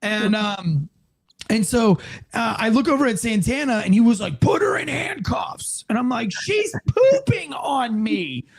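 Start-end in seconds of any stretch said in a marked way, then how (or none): 4.4-5.9 high, slow hoarse-whispered command; strained urgent order. Retold or imitated. Partner ordering the officer to cuff her, strained hushed yell. 6.3-8.5 high, drawn-out mock wail; panicked wail. Officer protesting to his partner, high panicked wail.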